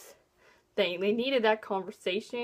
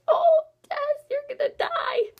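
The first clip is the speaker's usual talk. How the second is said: mock grief; 14 semitones higher, 6 dB louder than usual. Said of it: "I was just like".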